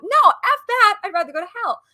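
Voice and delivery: loud and high retold outburst; angry outburst. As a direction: spit out the refusal in disgust.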